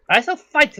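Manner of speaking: put-on a young warrior girl declaring. Half-elf girl volunteering to her father, lightly girlish. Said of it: "No, I loved it".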